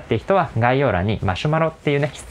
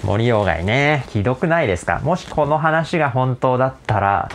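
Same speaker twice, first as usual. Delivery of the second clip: ordinary talk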